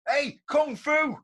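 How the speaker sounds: drawn-out high call of a nickname; calling out across the street. Retold or imitated. Old shipyard mate calling out a nickname, high and drawn-out. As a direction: shout the nickname slowly across the town. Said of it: "I hear this voice go".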